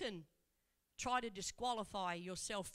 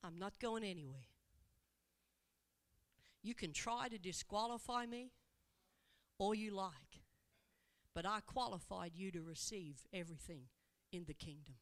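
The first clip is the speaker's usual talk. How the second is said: tender reassurance; 3 semitones lower than usual. Acted. Jesus speaks tenderly to woman.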